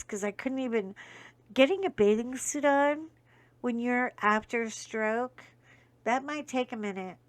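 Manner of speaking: ordinary delivery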